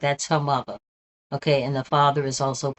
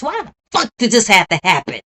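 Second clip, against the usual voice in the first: higher and louder, furious cry; angry outburst; 5 semitones higher, 8 dB louder than usual. Real, not acted. Streamer raging to viewers about the girl's killing, a furious outburst. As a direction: let the outrage burst out. Streamer erupts over child's murder.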